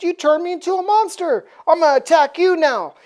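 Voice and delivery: high, fast indignant burst; hammed-up angry threat, quick and rising in force; mock outrage. Death turning on Apocalypse, mock-outraged.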